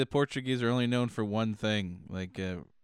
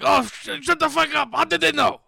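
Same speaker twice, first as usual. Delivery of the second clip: much higher, louder put-on angry voice; angry Portuguese listener; 9 dB louder than usual. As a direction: spit it out as an offended foreigner. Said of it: "They're like".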